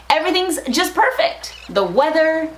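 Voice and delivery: normal talking voice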